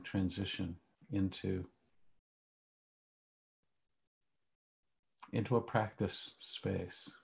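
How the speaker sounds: calm grounding exercise. Acted. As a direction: guide them gently into stillness. Leads a guided meditation.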